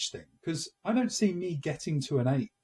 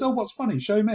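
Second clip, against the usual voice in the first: curious wife; 7 semitones higher, 5 dB louder than usual. Retold or imitated. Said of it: "She goes".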